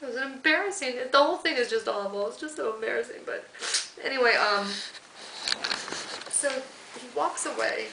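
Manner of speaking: choking up, high and breaking, some words whispered; fighting back tears while apologising; real crying. Real, not acted. Woman tells interviewer of her humiliation, crying for real.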